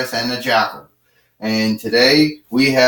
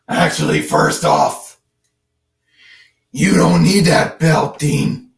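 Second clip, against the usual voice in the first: strained laryngitis-style Batman growl; gravelly Batman. Acted. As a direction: growl it out like a hoarse Dark Knight. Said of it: "like you got laryngitis or whatever".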